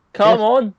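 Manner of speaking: high, loud, badly accented party-guy catchphrase; bad Adam Rose impression. Co-host mimicking a partying wrestler, loud bad accent. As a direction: call it out high in a terrible put-on accent. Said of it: "With the worst accent you'll ever hear".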